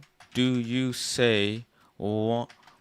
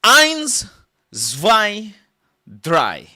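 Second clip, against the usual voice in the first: high loud barked count; mock German counting; 11 semitones higher, 14 dB louder, much slower than usual. Comic asked to count in German, loud high barks. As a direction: shout each number high, dropping at the end.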